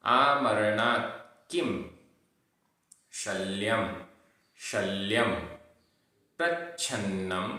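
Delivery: careful recitation with long gaps between words; pronunciation drill. Shown as a demonstration. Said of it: "उच्चारण".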